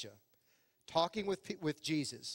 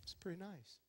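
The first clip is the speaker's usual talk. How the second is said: much softer, deadpan understatement; played cool; 16 dB quieter than usual. Shown as a demonstration. Adult playing it cool on entering, muted deadpan. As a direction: hold the excitement in, too cool. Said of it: "we're like".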